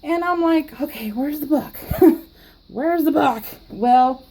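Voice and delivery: ordinary talk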